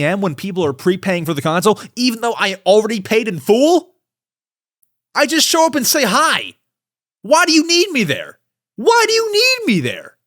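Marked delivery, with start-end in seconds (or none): 1.9-8.4 much higher, worked-up protest; heated rant. Real, not acted. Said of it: "I'm heated". 8.8-10.2 much higher, near-shrieking question; heated outburst. Real, not acted. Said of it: "I'm heated".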